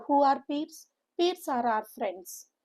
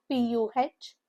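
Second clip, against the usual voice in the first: usual speaking voice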